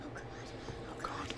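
breathy whisper, barely a sound; terrified whisper. Real, not acted. Hiding in the dark from him, terrified whisper. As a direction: gasp it out in hiding.

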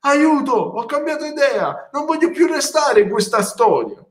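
a scared dragon wanting out; mock panic. Dragon panicking before the giant, high and hammed-up.

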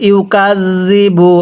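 ইউকাযযিবু with soft zal, slow recitation; drawn-out ইউকাযযিবু, zal said softly. Shown as a demonstration. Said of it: "তাহলে আমার সাথে পড়ুন". (ইউকাযযিবু নরম জাল দিয়ে ধীরে)

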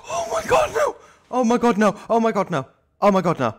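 terrified while being chased; real panicked shrieking; panicked cry. Real, not acted.

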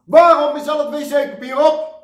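very high, very loud screamed complaint; screaming. Acted. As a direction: shriek it down the supermarket aisle. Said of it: "Of gewoon in de supermarkt".

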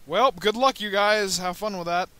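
loud, high gloating sendoff; taunting shout. Real, not acted. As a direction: jeer at the enemy, loud and high. Taunting the enemy ship.

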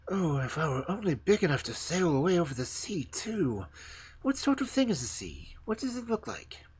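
put-on high, longing young tree voice; eager young fir tree. The fir tree asks the stork about the sea, high and eager. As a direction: ask like a child who wants to know.

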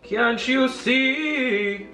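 very slow, high sing-song; drawn-out. Acted. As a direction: stretch each word out slowly. Slow drawn-out question to viewers.

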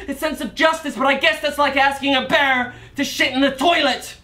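indignant. Acted. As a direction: plead for justice with angry force. Accuses prosecutor of lacking mercy.

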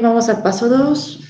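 cheerful classroom call to move on; upbeat announcement. Shown as a demonstration. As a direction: lift it up like calling the class onward. Teacher announces step two brightly.

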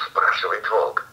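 ordinary delivery

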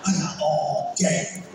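smug taunting teller. Retold or imitated.

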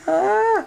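sudden high loud yelp; startled yelp. Real, not acted. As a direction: yelp as you lose your footing. Sudden cry, about to fall.

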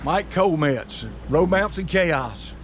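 slow announcement. Shown as a demonstration. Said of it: "Say your name".

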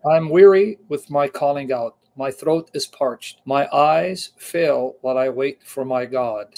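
fervent Bible reading; emphatic scripture reading. Acted. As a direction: proclaim the psalm like a prayer of distress. Reads psalm aloud.

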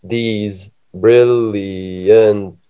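very slow, drawn-out syllables; counting syllables. Shown as a demonstration. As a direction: stretch out 'brilliant' bit by bit. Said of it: "Can I do that?".

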